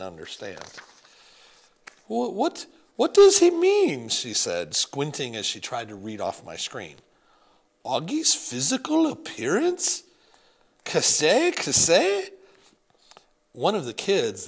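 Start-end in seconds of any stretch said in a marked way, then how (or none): 2.1-4.1 much higher, louder, slow puzzled French grandmother; baffled in a thick French accent. Acted. Grandmother puzzling over the email, French accent. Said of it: "Grandmere had a thick French accent". 7.8-12.3 much higher, louder French bafflement; puzzled French accent. Acted. Grandmother reading off the screen, confused French accent. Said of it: "Grandmere had a thick French accent".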